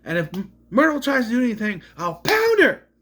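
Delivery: high, loud threatening squeal; mock threat. Friend imitates Jumbo threatening Myrtle, high loud squeal. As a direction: bark it like a furious little bully. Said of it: "she's like".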